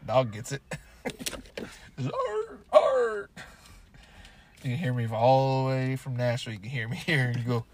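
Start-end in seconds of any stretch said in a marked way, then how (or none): 2.0-3.3 high, loud barking yelps; loud yappy dog barks; dog bark imitation. Shown as a demonstration. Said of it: "I'm gonna sound like your dog bark".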